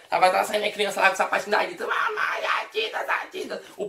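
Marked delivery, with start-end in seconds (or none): 1.9-3.6 mock baby squeal, much higher and breathy; baby's first words. Newborn baby calling out to mom, mock squeal.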